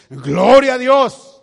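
loud, high, slow cry of praise; shouted praise. Acted. Believer loudly praising God after losing everything. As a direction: shout it to the rafters.

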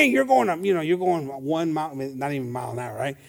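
normal talking voice

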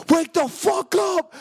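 high, loud, drawn-out wake-up yell; acted urgent yell. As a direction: yell to rouse a sleeping brother. Brother urgently waking him.

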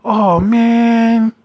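mocking the needy date at goodbye; mock clingy whine. Imitating a clingy dater's sad "Oh, man.". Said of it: "You know how people do that".

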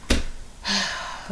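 long, soft exhale; calming sigh. Real, not acted. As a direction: let out a breath to calm down. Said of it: "okay, let me calm down".

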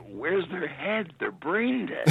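high-pitched, incredulous exclamation; exasperated disbelief. Real, not acted. Parent exclaiming over teenagers' lack of sense.

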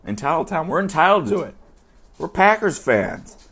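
hammed-up entitled fan; mock entitlement. Packers fan claiming he deserves it, smugly mock-proud.

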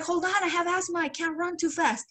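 imitated runner struggling to keep up; pleading protest. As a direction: beg them to wait, pitched well up. Protests while running with asthma.

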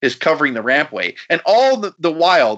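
usual speaking voice

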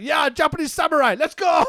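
imitating a hyped-up wrestler's rallying shout. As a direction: yell it to the camera like a battle cry. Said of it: "But he's just like".

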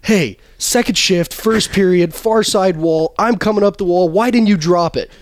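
high, loud, slow griping at a teammate; bench argument. Retold or imitated. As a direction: complain he never got the puck. Brothers arguing on the bench.

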